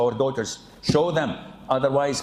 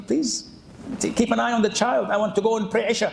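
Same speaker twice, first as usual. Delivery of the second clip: lightly acted wife's request, higher and hurried; retold plea; 8 semitones higher, much faster than usual. Wife asking husband to watch the child, mock plea. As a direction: mildly voice a pleading wife.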